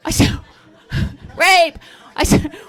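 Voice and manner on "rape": mock shout. Shouting at husband in hallway.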